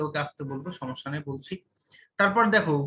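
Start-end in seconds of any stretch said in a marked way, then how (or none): 0.1-1.5 much softer, agreeing to go gently; quiet, obliging reassurance; softly, on request. Real, not acted. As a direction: drop the voice as asked. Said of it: "ভাই একটু আস্তে আস্তে বলেন".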